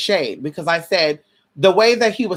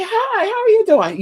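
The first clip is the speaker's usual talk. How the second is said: greeting pitched way up, light and friendly; high bright greeting. Shown as a demonstration. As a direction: chirp it up high like a cheerful hello. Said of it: "you would say".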